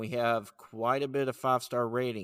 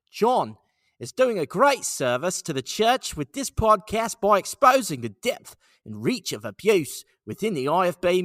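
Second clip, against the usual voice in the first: mock Australian accent, higher and louder; 7 semitones higher, 7 dB louder than usual. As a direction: read the review like an Aussie, pitched up and loud. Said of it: "let's do Australia".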